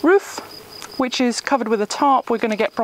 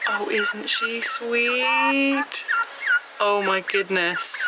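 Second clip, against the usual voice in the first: cooing; 3 semitones higher, much slower than usual. Real, not acted. Cooing at new chicken.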